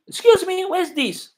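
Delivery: high, loud mock English stranger, no greeting; curt stranger. African man mocks a stranger who asks without greeting, high and loud. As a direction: play the rude passer-by who skips hello.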